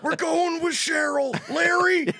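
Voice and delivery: high, slow strained shout; Super Dave voice. Retold or imitated. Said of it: "I sounded like Super Dave".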